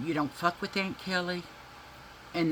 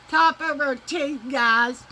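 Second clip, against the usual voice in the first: laughing; 8 semitones higher, 6 dB louder than usual. Real, not acted. Laughing, repeating to audience.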